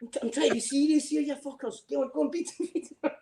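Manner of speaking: high, giggling mock orders; urging them on through laughter; laughing while imitating. Soldier egging the crowd on, laughing mock orders. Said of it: "I'm like".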